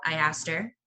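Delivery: usual speaking voice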